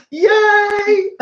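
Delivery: long high-pitched yay; excited cheer. Real, not acted. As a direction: squeal a delighted cheer. Cheering new member count.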